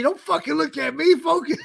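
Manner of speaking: high yelled coaching command. Retold or imitated. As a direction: bark it like a coach mid-match. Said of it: "I was coaching".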